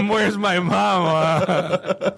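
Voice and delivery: put-on very slow, whimpering baby plea; mock baby voice. Puppet sucking its thumb cries for mama, slow babyish. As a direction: drag it out like a weepy toddler.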